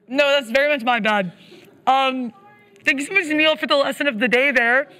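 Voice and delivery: ordinary talk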